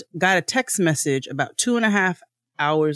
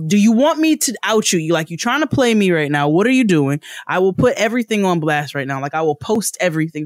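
angry; 4 semitones higher, 4 dB louder than usual. Retold or imitated. Threatening customer service on Twitter.

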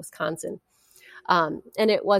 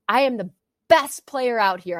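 louder, higher, fierce and convinced; said like she means it; 5 semitones higher, 5 dB louder than usual. Retold or imitated. Player to coach Marcy, finally saying it with conviction. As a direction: declare it with full belief. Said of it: "Say it like you mean it".

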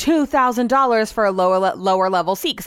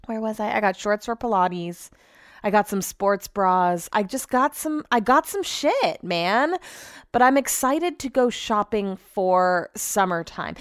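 ordinary delivery

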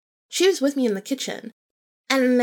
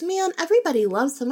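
higher, quick, lightly protesting; imitated friend's disbelief; 8 semitones higher, faster than usual. Friend protesting her dislike of him, imitated disbelief. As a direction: play a mildly astonished friend.

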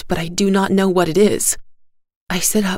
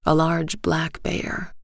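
ordinary delivery, nothing marked

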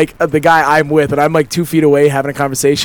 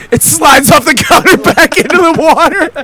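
much higher, breaking up laughing; laughing exclamation; 9 semitones higher than usual. Real, not acted. Telling friends the phone slid back in, laughing high. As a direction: squeal the punchline through laughter.

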